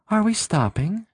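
high, questioning woman's voice. A narrator's reading. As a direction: ask it lightly, as Sylvia. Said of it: "It was Sylvia's voice now".

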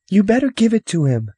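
put-on high, loud child's voice; insistent little girl. Sister ordering her brother to give it back, insistent girl voice. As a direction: pipe up like a bossy sister.

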